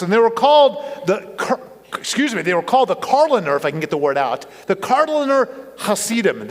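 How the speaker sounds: ordinary talk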